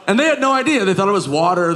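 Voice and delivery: ordinary talk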